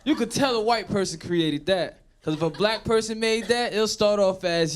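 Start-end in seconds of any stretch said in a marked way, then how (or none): none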